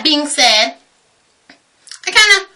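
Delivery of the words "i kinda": ordinary talk, nothing marked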